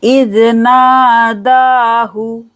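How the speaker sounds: इज़ नादाहु slow, naa and daa held long, hu short; chanted इज़ नादाहु with two long vowels; stretched naa-daa. Shown as a demonstration. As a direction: draw out नादा, then a quick हु. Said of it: "नादा को खींचेंगे".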